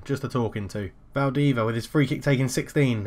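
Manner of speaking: usual speaking voice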